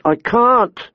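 put-on high, helpless boy's voice; blurted protest. A narrator's reading. Boy unable to dismount, blurting in protest. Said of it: "Bran blurted".

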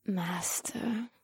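breathy moan of Master. Acted. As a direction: moan it in a whisper. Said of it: "Akira moaned".

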